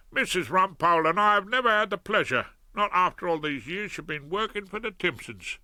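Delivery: a lightly voiced cheerful South London crook; cheery Fred. Fred greets Hilda.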